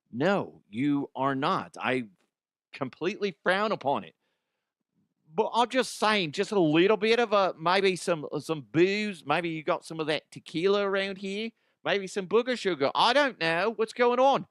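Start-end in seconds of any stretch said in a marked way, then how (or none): 5.3-14.5 high, rowdy party-guy voice; Ozzy voice. Acted. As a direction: pitch it up and beg for booze. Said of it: "Ozzy DeHuff".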